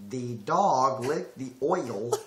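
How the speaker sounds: neutral, evenly placed words; attempted accentless. Shown as a demonstration. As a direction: hold back the southern accent word by word. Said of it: "Now without an accent".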